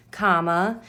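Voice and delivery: ordinary delivery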